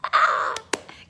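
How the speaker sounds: high-pitched, loud burst of laughter. Real, not acted. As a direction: squeal with real laughter. Laughs over the deliverance service.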